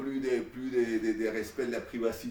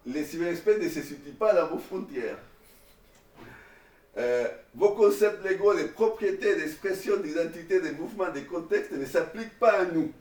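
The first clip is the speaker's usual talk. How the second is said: raised, solemn reading voice; higher and louder, proclaiming each clause; declaiming the declaration; 6 semitones higher, 5 dB louder than usual. Acted. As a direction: read it out like a manifesto to the class. Reads out the declaration aloud.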